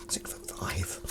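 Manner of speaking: whispering the number in awe; hushed, breathy repeat to himself; mock-awed whisper; whispered aside.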